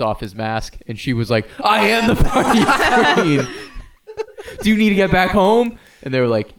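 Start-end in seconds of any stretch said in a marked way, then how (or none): 1.6-5.8 grand queen reveal. Acted. Centaur unmasks as the queen.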